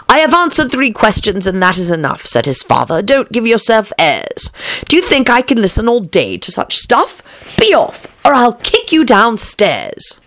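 high, indignant poem recital; scolding verse recitation. A narrator's reading. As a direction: recite the rebuke, slowing on the final threat. Alice recites father's rebuke.

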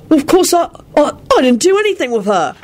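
high, sheepish grinning reply. A narrator's reading. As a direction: answer with a flustered grin. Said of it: "replied with a grin".

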